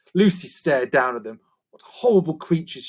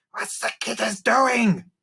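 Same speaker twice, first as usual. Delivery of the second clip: slow, higher creaky goblin voice; creaker voice; 8 semitones higher than usual. A narrator's reading. Narrator voicing a creaker asking Lucy, in a slow creature voice. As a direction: squeak it out like a little creature.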